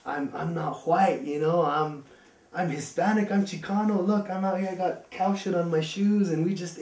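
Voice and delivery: building pride in fitting in; proud new member. Retold or imitated. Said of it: "he's like".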